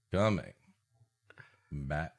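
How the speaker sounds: normal talking voice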